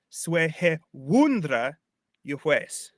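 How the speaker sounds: put-on slow, drawn-out Old English verse; Old English recitation. Shown as a demonstration. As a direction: recite deliberately, leaning on "wundra". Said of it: "This next line".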